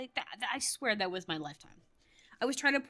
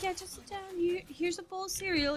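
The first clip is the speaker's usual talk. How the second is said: defusing a bomb; 10 semitones higher than usual. Retold or imitated. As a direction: imitate a dad gingerly handling a meltdown. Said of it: "trying to defuse a bomb".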